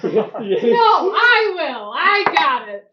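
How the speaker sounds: loud, high, drawn-out eager claim; excited. Real, not acted. Player volunteering for a perception roll, excited and loud. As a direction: jump in loudly to take the roll.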